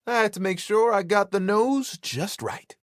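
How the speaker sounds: ordinary talk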